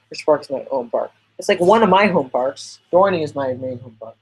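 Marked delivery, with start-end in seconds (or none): none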